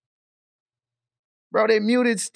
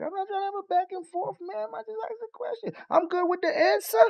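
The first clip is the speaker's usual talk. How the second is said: high mocking impression of a snappy mayor; mock her curt tone way up high; high, snippy, mocking the mayor; 12 semitones higher, 6 dB quieter than usual. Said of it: "why you so snappy".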